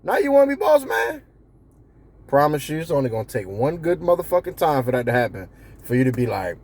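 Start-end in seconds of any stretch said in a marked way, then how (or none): 0.0-1.2 high, scoffing jeer; mock the boss's drunk night; mocking team. Team mocking the boss after a drunken night. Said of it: "They all looking at you like".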